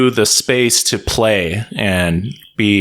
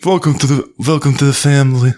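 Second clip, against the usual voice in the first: Marlon Brando; 4 semitones higher than usual. Acted. Podcaster welcomes listeners into the family, failed Brando impression. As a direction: attempt a bad Italian godfather voice. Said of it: "I'm trying to do, like, a Marlon Brando".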